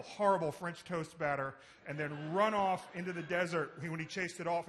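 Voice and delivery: ordinary delivery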